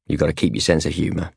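ordinary delivery, nothing marked

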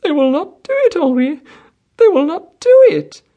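put-on very high, childlike wail; spoiled wife wailing to her husband; tearful stammer. A narrator's reading. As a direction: whimper loudly like a thwarted child. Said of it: "a voice full of tears, the voice of a child".

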